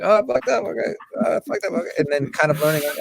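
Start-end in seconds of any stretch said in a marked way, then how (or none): 0.0-1.9 high, sheepish mock realization; mock chastened tweeter. Scene: imitating Twitter users learning a lesson, high sheepish voice. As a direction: play the caught-out poster, pitched up. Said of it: "just like".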